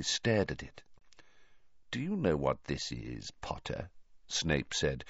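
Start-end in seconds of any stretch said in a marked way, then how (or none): 1.9-3.9 low, soft and menacing; quiet dangerous threat. Acted. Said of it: "his eyes glittering dangerously".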